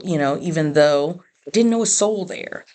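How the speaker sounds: ordinary talk, nothing marked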